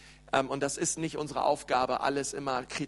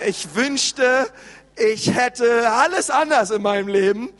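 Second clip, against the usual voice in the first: higher, louder wailing wish; discontented wail; 6 semitones higher, 8 dB louder than usual. Retold or imitated. Preacher voicing a discontented person's wish, wailing higher and louder. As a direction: cry out the wish like a dissatisfied person.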